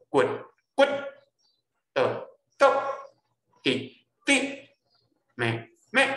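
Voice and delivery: 骨, 的, 滴, 咩 each said low, then high, cut short; low versus high stop tones. Shown as a demonstration. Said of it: "用两个做比较". (「骨、的、滴、咩」先低後懸做比較)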